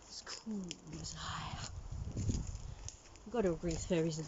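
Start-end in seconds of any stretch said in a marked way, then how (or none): 0.1-1.7 soft conspiratorial whisper. Real, not acted. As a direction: breathe it as a secret aside. Aside whispered to camera.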